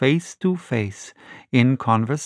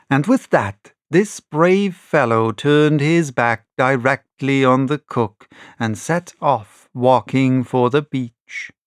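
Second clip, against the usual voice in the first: plain reading voice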